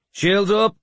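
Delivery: high, loud shouted order; battle shout. A narrator's reading. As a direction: shout the command to the shield wall. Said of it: "he shouted".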